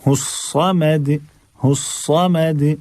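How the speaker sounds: slow हुस्समद with a thick, full S; drawn-out हुस्समद, emphatic S; heavy S. Shown as a demonstration. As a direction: recite हुस्समद, dark A after the S. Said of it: "ये भी मोटा लफ्ज है".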